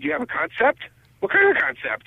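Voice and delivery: intrigued question, pitched high; high, curious replayed reaction; retold surprise. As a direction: act out being caught by surprise, voice rising. Retells his reaction to news.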